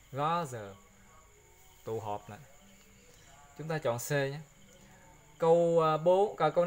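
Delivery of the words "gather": Gather said as "gɔzɤ", th as a voiced z sound; corrected gather. Shown as a demonstration. Teacher modelling the right Gather for the class, clear and careful. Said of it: "gather là mới đúng".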